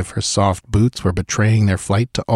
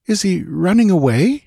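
uneasy; 10 semitones higher than usual. A narrator's reading. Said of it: "inquired Valeria uneasily".

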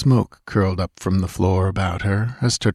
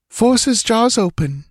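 much higher, firm woman's order; commanding; 13 semitones higher than usual. A narrator's reading. Dancer ordering Conan to open the madman's jaws, firm command. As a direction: give the order with no room to argue. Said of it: "she commanded".

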